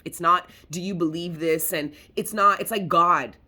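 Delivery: fed-up, heated scolding of the viewers; exasperated rant. Real, not acted. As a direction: vent at doubters who won't listen. Frustrated rant at doubting viewers.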